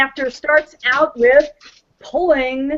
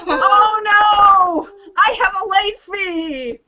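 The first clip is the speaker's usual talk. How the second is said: mock dismay; 7 semitones higher, slower than usual. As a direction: play it as fake panic.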